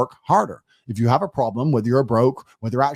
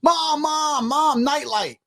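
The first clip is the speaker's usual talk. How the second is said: put-on much higher, a little kid calling out to his mom; 12 semitones higher, much slower than usual. Friend calls to his mom from bed, high kid voice. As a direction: pipe up like a scared five-year-old. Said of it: "Ryan started screaming at his mom".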